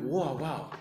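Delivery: very soft, low wonder; hushed amazement. Retold or imitated. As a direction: recall being amazed, almost under your breath. Retells his amazed reaction to wife.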